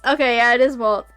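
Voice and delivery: normal talking voice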